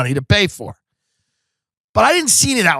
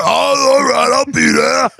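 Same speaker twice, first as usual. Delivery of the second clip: high, hammed-up grudging voice; mock aggrieved holler; reluctant repairman; 9 semitones higher, slower than usual. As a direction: moan it like a put-upon repair guy. Mocks reluctant repair guy on phone.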